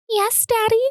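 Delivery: high-pitched teasing call; high mock "Yes, Daddy". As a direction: put on a high, cutesy call to tease. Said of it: "like that".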